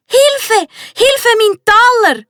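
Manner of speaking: high, loud panicked cry for help; child pirate shrieking in alarm; screaming for help. A narrator's reading. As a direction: shout as your treasure rolls toward the sea. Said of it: "schreit der Max".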